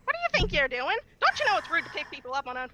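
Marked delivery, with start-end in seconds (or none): none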